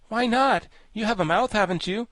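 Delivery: put-on high, loud boyish questioning; high-voiced storybook boy; challenging boy. A narrator's reading. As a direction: press the point as a young lad.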